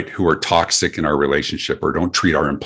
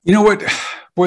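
excited exclaim; 13 semitones higher, 3 dB louder, faster than usual. Real, not acted. Guest to host, thrilled by question, excited exclaim. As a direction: burst out high and loud with delight.

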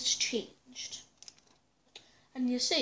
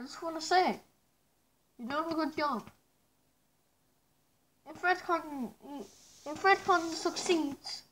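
muffled behind respirator; speaking through gas mask; 2 semitones higher than usual. Real, not acted. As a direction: voice inside face mask. Seen 0: Full gas mask covers mouth throughout; refs show bare face.

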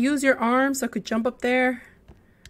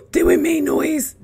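louder alarmed question; startled worry; 2 semitones higher, 8 dB louder than usual. Real, not acted. Player afraid the monster heard, alarmed. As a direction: catch yourself, alarmed.

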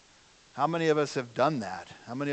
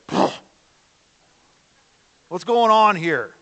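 a snort, then a louder, higher indignant question; imitating an indignant Jesus, louder; 5 semitones higher, 5 dB louder than usual. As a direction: snort like a horse, then demand an answer. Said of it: "snorting with indignation".

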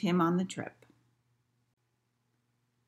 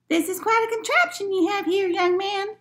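put-on high-pitched old lady character voice; high old-lady storybook voice; 14 semitones higher, 3 dB louder than usual. A narrator's reading. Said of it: "said the old lady".

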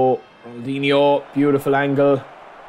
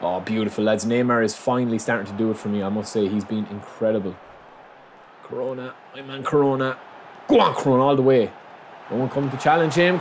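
normal talking voice

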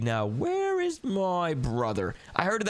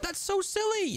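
squeaky mock. Mocking brother from hiding.

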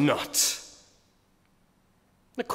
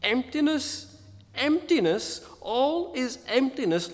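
higher, intoned lament of emptiness; weighty lament; 6 semitones higher, 3 dB quieter than usual. Shown as a demonstration. Preacher voices the verse for his listeners, a higher weighty lament. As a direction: let each emptiness land with weight. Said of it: "Feel the point".

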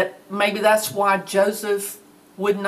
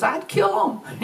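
put-on much higher kid outburst; boy blurting; 12 semitones higher than usual. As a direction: squeal it like a fierce little boy. Preacher voicing boy, then laughs.

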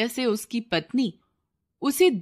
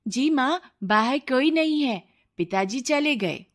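higher, lightly voiced child; young boy; 5 semitones higher than usual. Son tells mother father has gone, lightly childlike. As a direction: report back to mother.

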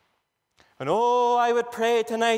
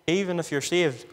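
ordinary talk

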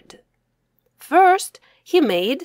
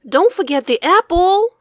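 put-on high and loud, eager little boy; eager reminder; 8 semitones higher, 7 dB louder than usual. Boy eagerly reminding his mom about the apple. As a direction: pipe up brightly with the reminder.

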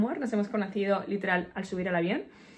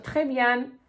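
raised, louder attempt at French, clumsy accent; bad French accent; 4 semitones higher, 6 dB louder than usual. Vlogger replying to the driver in French, bad French accent. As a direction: act out hopeful beginner French, badly accented. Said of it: "tan mal acento tengo".

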